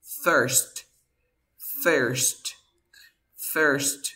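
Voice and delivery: "first" drilled with an E vowel; teacher repeating "first" with an E sound. Shown as a demonstration. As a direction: say "first" as separate models, i like E. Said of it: "Primero se pronuncia".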